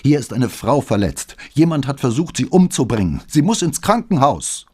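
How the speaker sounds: frantic caller raising her voice; voice rising. Acted. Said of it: "Flavias Stimme wurde lauter".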